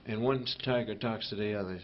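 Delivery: ordinary talk, nothing marked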